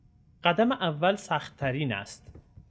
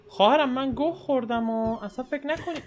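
pleading put-on voice; 8 semitones higher than usual.

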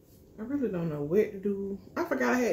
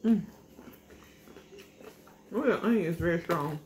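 eating between words; chewing while talking. Real, not acted. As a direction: mouth partly full of food. Seen 0: Bit taco at -3; lips pursed, chewing while holding taco.

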